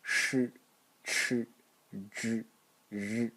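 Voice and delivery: sh, ch, zh with the tongue curled back; demonstrating Mandarin retroflex sounds. Shown as a demonstration. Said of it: "the retroflex one".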